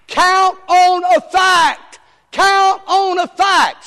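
high, loud and slow, each word hammered; shouted slogan. Shown as a demonstration. Preacher drilling the key phrase to the church, shouting. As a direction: bellow it like a rallying cry. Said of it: "Are you ready for this?".

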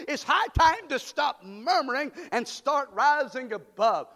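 loud, high rallying shouts; preaching at full force. Acted. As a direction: fire the crowd up, hammer each line. Preacher exhorting congregation.

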